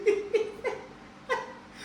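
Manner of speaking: high breathless laughing cry; high airy laugh. Real, not acted. Recalling dad's beatings, high breathless laugh.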